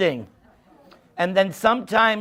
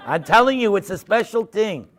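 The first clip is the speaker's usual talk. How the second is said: ordinary talk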